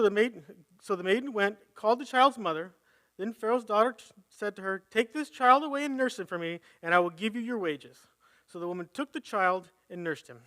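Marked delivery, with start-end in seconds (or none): none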